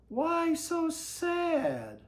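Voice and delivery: high, slow, caring question; a lightly voiced kindly old man; concerned grandpa. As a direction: stretch it out with gentle worry. Barney voiced in story.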